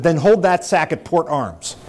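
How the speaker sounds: slow, loud, high military bellow. Retold or imitated. Gunnery sergeant orders prisoner to port arms, drawn-out shout. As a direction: stretch out the barked command. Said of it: "louder and louder and louder".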